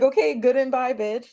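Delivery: high, sassy goodbye; mocking sass. Mocking the mom's goodbye back at her.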